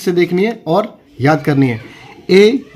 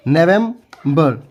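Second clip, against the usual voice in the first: "November" slow and split, nevem... ber; broken into syllables; much slower than usual. Shown as a demonstration. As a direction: say "November" in two separated chunks. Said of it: "आप इसको गलत नहीं पढ़ेंगे".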